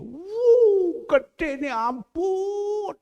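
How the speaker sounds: high, loud astonished gasp; amazed. Retold or imitated. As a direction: marvel at how huge it is. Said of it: "Apa yang dia jawab".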